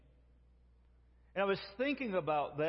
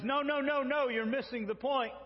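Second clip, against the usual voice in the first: higher and louder, cutting in; firm interruption; 8 semitones higher, 3 dB louder than usual. Acted. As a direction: stop Peter mid-sentence. God cuts off Peter.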